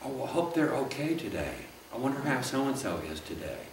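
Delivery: hushed caring words about an absent member; caring concern. Shown as a demonstration. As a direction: voice real worry, gently and softly. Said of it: "tell me".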